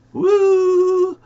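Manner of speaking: high, loud whoop of delight; a sudden high whoop at his own joke; excited whoop. Real, not acted.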